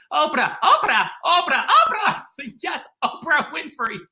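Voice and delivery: high, loud excited chanting of the name; shrieking the news to mom over the phone; excited squeal. Retold or imitated. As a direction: gush like you can't contain it. Telling mom he saw Oprah.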